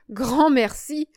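high, loud mock thanks; amused. A narrator's reading. Serge laughing off the bet, high and amused. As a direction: decline with lightly amused, lifted voice. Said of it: "dit Serge en riant".

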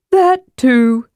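high, drawn-out character voice; Tass conceding. A narrator's reading.

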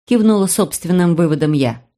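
plain reading voice

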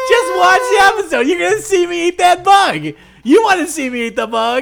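hammy principal. Pretend. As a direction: tease the crowd like a goofy principal. Principal teasing the students.

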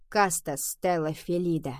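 ordinary delivery

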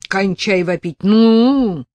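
big man yells at the wailing prophet; yelling at him. Acted. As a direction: bark at him to shut up. Big man yells at sobbing Lyonka.

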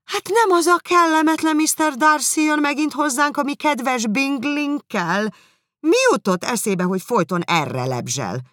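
high, loud fretful mother; shrill complaint about the guest; Mrs. Bennet fussing. Acted.

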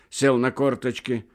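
ordinary delivery, nothing marked

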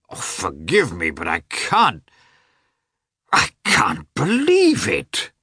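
falter, then cry out slowly in disbelief; pained disbelief. A narrator's reading. Oblonsky to Karenin about the divorce, pained disbelief.

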